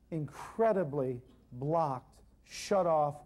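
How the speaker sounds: ordinary talk, nothing marked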